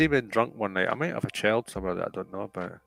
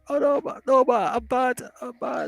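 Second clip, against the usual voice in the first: very high hammed-up wail; mock wailing apology; 13 semitones higher than usual. Guest begging Matt's pardon as a bad boy, mock wail. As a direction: wail with fake remorse, pitched way up.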